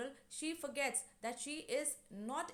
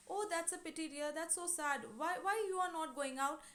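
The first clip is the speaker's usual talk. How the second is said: higher-pitched, mildly acted sympathy; pitying; 6 semitones higher than usual. Neighbour consoling Doris about her date, pitying. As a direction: coo with concern, then ask why.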